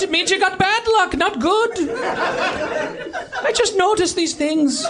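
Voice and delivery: observational stand-up delivery in mock Irish; Jerry Seinfeld, Irish. Comic asking the audience about clovers and rainbows, Irish accent. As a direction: riff quizzically, putting on an Irish accent. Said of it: "Irish Jerry Seinfeld".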